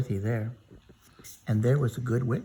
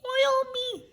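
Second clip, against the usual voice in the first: very high, thin cry for help; storyteller voicing a high squeaky Tin Man.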